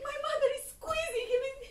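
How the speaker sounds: ordinary delivery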